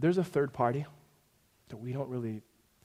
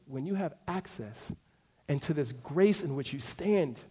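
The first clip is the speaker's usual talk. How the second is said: usual speaking voice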